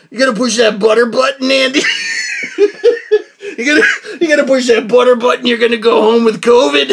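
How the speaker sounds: loud, laughing mock challenge; laughing taunt. Vlogger teasing himself about the butter button, laughing loudly. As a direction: egg yourself on through laughter.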